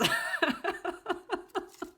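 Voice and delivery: high-pitched laugh; real laughing fit. Real, not acted. Reader cracking up at their own screaming act, laughter. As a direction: burst out laughing, high and helpless. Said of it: "I'm laughing".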